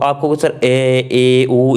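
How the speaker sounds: drawn-out vowels ए and ऊ, said slowly; vowel demo. Shown as a demonstration. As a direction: sound out ए, ऊ with the mouth half open. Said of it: "जिसके उच्चारण में आधा मुख खुला रहता हो".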